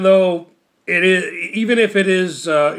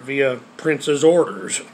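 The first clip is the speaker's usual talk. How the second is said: ordinary talk, nothing marked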